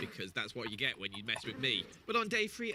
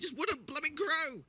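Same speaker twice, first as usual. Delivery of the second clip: exasperated; 4 dB louder than usual. Real, not acted. Exasperated that trees won't grow.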